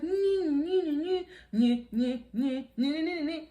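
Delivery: high, whiny gibberish nagging; mock nagging. Chevelle nagging the man in his ear, mocking imitation. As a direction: mimic incessant nagging in a high "nee nee". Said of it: "She was nagging the man in his ear".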